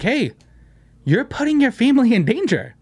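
imitating an online scold; mocking commenter.

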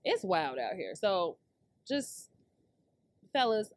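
ordinary talk, nothing marked

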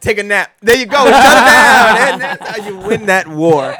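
loud, high crowing with laughter; gloating triumph. Real, not acted. Winning the argument against a friend, loud laughing gloat. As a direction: laugh and shout like you just won.

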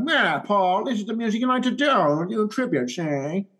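put-on high, quick old-timey gangster voice; lightly imitating a thirties detective; 1930s detective voice. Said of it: "his nineteen thirties detective voice".

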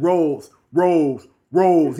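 loud calling out, imitating a frustrated boyfriend; retold yelling. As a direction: shout the name insistently. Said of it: "He keeps yelling".

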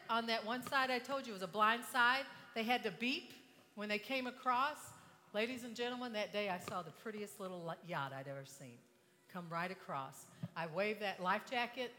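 ordinary talk, nothing marked

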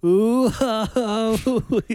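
very high, loud, squeaky ooh ah; mock squeaky oohs. Mimicking the reality half of the TikTok, very high squeaky ooh ah. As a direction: parody the sound gone wrong, squeaking. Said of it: "and then it goes".

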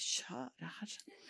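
a startled inner whisper to God; whispered prayer. Retold or imitated. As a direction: breathe it out unvoiced, like a thought. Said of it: "Jeg tenkte".

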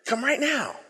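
quiet repeat. Shown as a demonstration. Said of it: "Isn't that an interesting flip?".

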